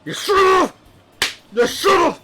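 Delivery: high loud shout; inner scream. Pretend. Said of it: "on the inside".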